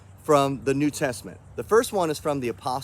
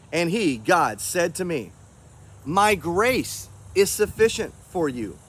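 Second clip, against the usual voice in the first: scripture reading; 3 semitones higher than usual. Acted. Reads scripture passage aloud.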